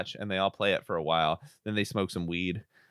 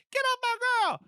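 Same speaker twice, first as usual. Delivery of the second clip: put-on very high squeaky kid yell; hammed-up little brother's outburst; huffy twelve-year-old. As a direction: squeal it like an indignant boy. Voicing boy yelling at kidnapper.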